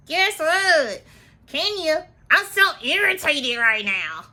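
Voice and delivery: high put-on voice, hammed-up annoyance; playful high-pitched impression; Kandi. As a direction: imitate someone irritated, high and stretched. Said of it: "that's my Kandi voice".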